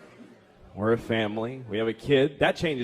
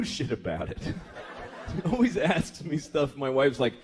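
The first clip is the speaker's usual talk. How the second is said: normal talking voice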